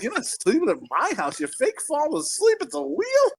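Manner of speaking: high, incredulous refusal; mock indignation. Retells refusing the faker.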